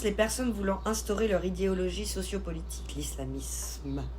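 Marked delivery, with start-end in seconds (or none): 2.9-4.0 "l'islamisme" slow, low and soft, final "isme" drawn out; pointed enunciation. Real, not acted. Streamer names the ideology being denounced, "l'islamisme" slowly spelled out. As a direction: drop low and stretch out "l'islamisme".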